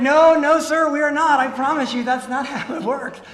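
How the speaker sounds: loud, laughing no, no; loud retold protest. Telling the scam victim loudly that it's not how it works. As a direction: shout him down, half laughing. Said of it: "And I was like".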